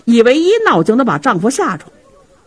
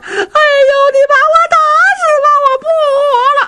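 wailing shriek; much faster than usual. Acted. Wife Feng howling under her husband's beating, shrill wailing. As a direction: wail as if begging to be beaten to death. Wife wails while being beaten.

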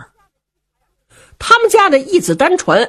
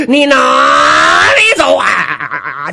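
slow, drawn-out laughing battle shout; a gloating warrior yells after the foe he is chasing, slow and laughing; taunting shout; 7 semitones lower, 4 dB louder, much slower than usual. Acted. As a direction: stretch the taunt out and roar it with a laugh.

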